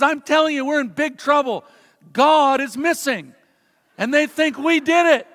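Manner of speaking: put-on panicked boy. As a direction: play a little boy insisting disaster has struck. Panicked boy acted in a joke.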